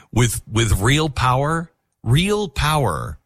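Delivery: "real" drawn out slowly and pressed hard; real stressed slowly. Shown as a demonstration. Host correcting himself, slowly leaning on "real".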